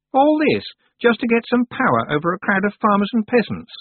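put-on high, sharp girl's voice; haughty young witch Anagramma. A narrator's reading. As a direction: voice the proud young witch, peevish. Anagramma's voice in dialogue.

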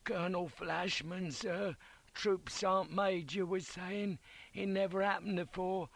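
put-on thin, high voice of a wounded boy; croaked little whisper. A narrator's reading. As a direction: croak it weakly from a sickbed. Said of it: "croaked out in a little whisper".

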